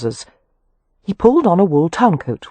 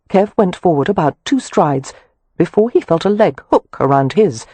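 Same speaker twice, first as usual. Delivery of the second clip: usual speaking voice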